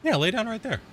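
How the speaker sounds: tuck the kitty in with a coo; cooing to cat. Real, not acted. Settling the kitty in the sun, high tender coo.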